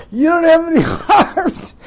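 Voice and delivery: incredulous laughing outburst; laughing disbelief. Real, not acted. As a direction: repeat it cracking up in disbelief. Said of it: "I'm just cracking up".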